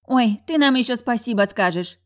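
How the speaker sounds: loud, high-pitched dismissive retort; scoffing. A narrator's reading. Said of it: "фыркнула Ларка". (громко, с насмешливым фырканьем)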